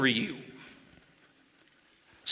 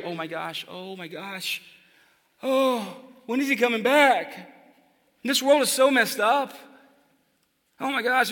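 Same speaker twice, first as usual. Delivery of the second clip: hushed then higher, fretting oh my gosh; mock panicked believer; 5 semitones higher than usual. Preacher imitating anxious people freaking out over the world. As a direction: play a frightened churchgoer freaking out. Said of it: "Here's what they do".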